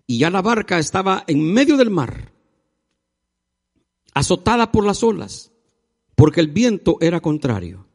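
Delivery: projected, deliberately phrased Bible reading; solemn scripture reading. A narrator's reading. Preacher reading the Gospel to the congregation, declamatory. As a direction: read it out to the whole church in measured phrases. Reads the Bible passage aloud.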